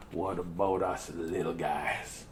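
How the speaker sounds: low, slow, soft plaintive line; little guy voice. Acted. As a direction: shrink down and ask it quietly. Small voice, read slowly.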